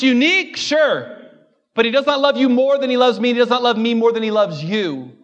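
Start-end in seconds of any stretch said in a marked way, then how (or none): none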